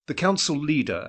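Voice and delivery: usual speaking voice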